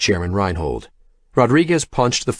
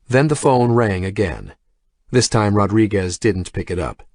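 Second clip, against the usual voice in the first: plain reading voice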